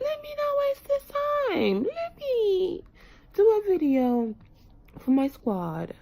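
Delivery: mock-chipper self-pep talk, way up high; inner voice. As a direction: squeak out the thought in a tiny high voice. Retold inner thought, squeaky voice.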